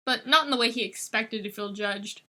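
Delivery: plain reading voice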